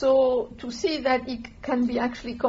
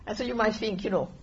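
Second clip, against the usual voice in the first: usual speaking voice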